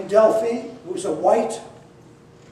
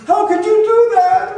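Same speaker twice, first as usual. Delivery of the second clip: higher and louder, an outraged yell; imitating the housemates' angry shout; retold yell; 11 semitones higher, 8 dB louder than usual. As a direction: housemates yelling at [name] for throwing her out, a loud shout. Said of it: "everyone in the house yells at me".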